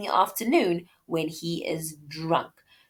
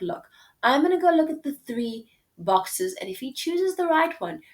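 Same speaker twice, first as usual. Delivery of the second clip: casual paraphrase; 7 semitones higher than usual. Retold or imitated.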